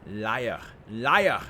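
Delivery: mock outburst in a grating voice, rising sharply; metallic grating voice. A narrator's reading. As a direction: accuse as a grating machine voice, building to a shout. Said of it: "metallic and grating tone".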